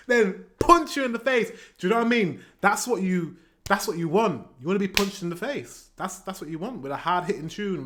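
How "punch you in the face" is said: raised, loud hit on punch; high, emphatic mock attack; sudden punchy burst. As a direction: land it like a playful jab. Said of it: "it's gonna be calm".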